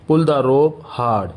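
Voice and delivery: each word slow and separate for learners; slow dictation pace. Shown as a demonstration. Teacher to Hindi students, model sentence said very slowly. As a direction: spell it out slowly so students can repeat. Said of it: "तो आप बोलेंगे".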